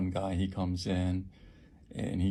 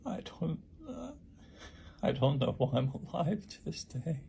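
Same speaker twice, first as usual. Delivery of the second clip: real tearful breakdown; 2 semitones higher than usual. Real, not acted. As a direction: struggle to get the words out through tears, with long pauses. Survivor breaking down over injuries.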